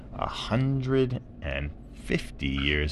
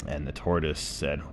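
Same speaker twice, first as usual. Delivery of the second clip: usual speaking voice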